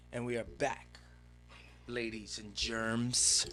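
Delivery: slow, drawn-out announcer voice; mock host intro. Returning from the break, a slow mock host greeting. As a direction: ham up the show intro, slow.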